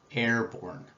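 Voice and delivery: normal talking voice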